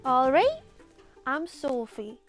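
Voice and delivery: normal talking voice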